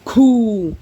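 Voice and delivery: high, loud mock-impressed "Cool"; excited cool. Classmate reacts to his power, an excited high loud cool. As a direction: blurt it out like a kid impressed by a quirk. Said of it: "And he goes".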